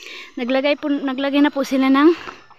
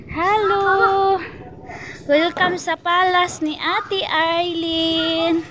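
high, drawn-out welcome call; slow sing-out greeting, pitched up; playful grand welcome; 4 semitones higher, much slower than usual. Pretend. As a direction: stretch it out like welcoming guests to a palace. Mock-grand welcome to the house.

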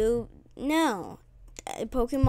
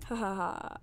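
whispered laugh; 3 semitones lower, 9 dB quieter than usual. Real, not acted. Quiet laugh after scoring.

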